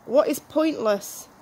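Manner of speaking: normal talking voice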